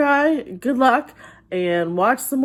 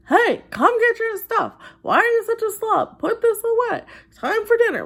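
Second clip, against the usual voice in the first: much higher mock parental yell; hammed-up yelling parent, pitched way up; 13 semitones higher, 4 dB louder than usual. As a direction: imitate a mom finally snapping at a messy kid. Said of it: "the parent's voice raises and they yell".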